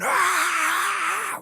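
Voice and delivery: high, loud mock battle scream; battle cry. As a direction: roar like a charging warrior, pitched very high. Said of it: "run into battle going".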